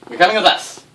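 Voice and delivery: loud, high "Nope!" as the heir is snatched; snatch the child and yell it; mock shout. GM voicing the Phoenix as they steal the heir, loud.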